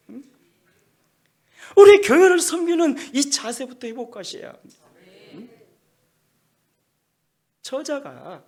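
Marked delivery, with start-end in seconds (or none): 1.8-4.8 loud, high preaching voice; full-force sermon delivery; forceful preaching. Acted. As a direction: preach it out to the congregation. Preaching peak.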